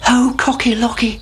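high anxious chick. A narrator's reading. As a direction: cry out like a worried chick. Chicken Licken alarmed.

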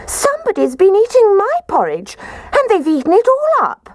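very high, squeaky cub voice; squeaking Baby Bear. A narrator's reading. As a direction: squeal it like an indignant little bear. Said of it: "positively squeaked when he said".

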